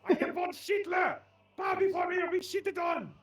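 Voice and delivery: loud, high villain proclamation; dark lord. Acted. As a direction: bellow like a cartoon tyrant. Villain proclamation, Palpatine joke.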